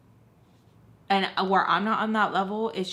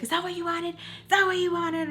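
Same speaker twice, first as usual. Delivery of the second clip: high sharp mom voice, repeated; imitated mom scolding; 10 semitones higher than usual. Retelling how her mother scolded her after shaking her, high and sharp. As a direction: throw it at her like an angry mom.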